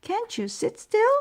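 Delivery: high, loud scolding; yelling mother. A narrator's reading. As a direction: call out in exasperation. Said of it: "yelled Mom".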